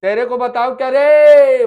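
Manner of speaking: loud, slow rude shout at family; a hammed-up child yelling at parents at home; mock angry shout. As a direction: bark it like a sulky kid shouting at home. Teacher acts a shouting child at home.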